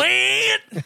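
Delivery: high, drawn-out mate; imitating his Aussie greeting; mock Aussie mate. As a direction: toss off a mock Australian mate. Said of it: "what does he always call you".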